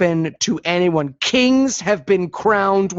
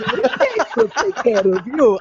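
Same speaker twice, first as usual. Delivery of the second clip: lightly voiced sinister count, pitched up; hammed-up creepy vampire promise; Count Chocula on meth; 4 semitones higher than usual. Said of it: "like he's Count Chocula on meth or something".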